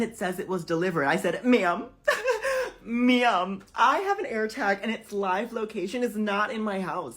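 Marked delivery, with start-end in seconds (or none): 1.4-7.2 high, loud, indignant insistence; acted customer outrage; exasperated protest. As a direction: push it out loud and high, close to losing it. Arguing with the luggage agent.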